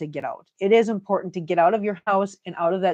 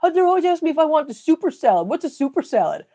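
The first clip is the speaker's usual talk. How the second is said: imitating a puzzled buddy; 7 semitones higher, faster than usual. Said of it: "He goes".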